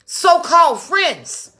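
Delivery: So-called drawn out slow, high and loud; stress on so-called. Real, not acted. Telling her viewers, bitterly stressing So-called friends. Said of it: "Do y'all hear what I say?".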